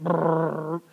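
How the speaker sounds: rolled motor brrr; mimicked engine whirr; imitated mower noise. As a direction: buzz like a riding mower. Imitates riding mowers bagging leaves.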